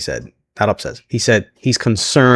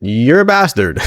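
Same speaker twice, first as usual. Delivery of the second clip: loud called-out name, then scolding; playful scolding of Drew; mock outrage; 6 semitones higher, 4 dB louder, slower than usual. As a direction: shout his name in playful disbelief. Shocked at Drew's low blow.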